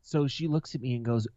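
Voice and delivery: usual speaking voice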